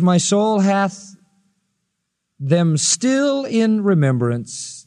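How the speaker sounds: drawn right out; very slow reading. Shown as a demonstration. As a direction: linger on every word. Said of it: "No, he says".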